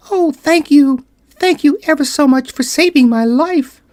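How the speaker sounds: high, tiny squeak of a grateful mouse; squeaky little mouse voice. Acted. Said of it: "in a squeaky little voice".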